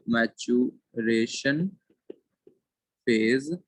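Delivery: very slow, word by word; slow dictating voice; dictation pace. Shown as a demonstration. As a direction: read it out slowly for notes. Dictating notes to class.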